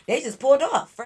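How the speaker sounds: outraged. Real, not acted. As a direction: let the outrage spill out. Outraged recap of friends leaving.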